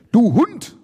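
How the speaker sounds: high, loud, furious outburst; angry, fearful insult. A narrator's reading. Ulf insulting an opponent in fear, angry. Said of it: "brummt Ulf Wut durch Furcht".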